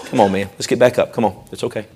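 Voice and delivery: quiet, coaxing and tender; hushed fatherly comfort; soft encouragement. Acted. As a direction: coax the child back up gently. Parent encouraging child.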